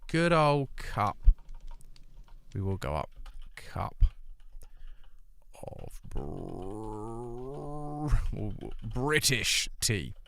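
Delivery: put-on slow, drawn-out Good old cup and British tea; slow emphatic. Shown as a demonstration. Emphasizing British tea for his American chat, slowly. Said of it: "I need to emphasize that".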